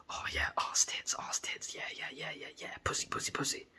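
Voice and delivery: breathy mock-lustful whisper, fading away. Mocking his own dirty thoughts, in a whisper. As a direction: whisper it like a guilty fantasy.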